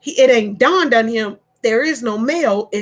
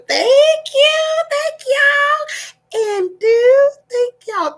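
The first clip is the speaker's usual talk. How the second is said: higher, louder gushing thanks; effusive thanks; 4 dB louder, much slower than usual. Real, not acted. Vlogger thanking viewers for typing mailbox. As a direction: gush gratitude to chat. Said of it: "thanks viewers effusively".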